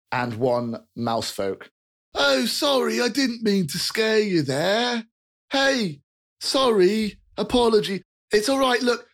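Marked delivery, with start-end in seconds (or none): 2.1-9.1 high, apologetic chubby fellow; jolly rotund man. Acted. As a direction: soothe the startled kid cheerfully. GM voices rotund human.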